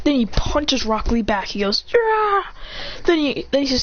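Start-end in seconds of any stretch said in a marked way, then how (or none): 1.9-2.5 high-pitched mock battle yelp; fight cry. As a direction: yelp as if mid-punch.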